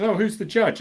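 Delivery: a touch of a curious mum; mum's voice. Retold or imitated. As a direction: mum quizzing her son about court.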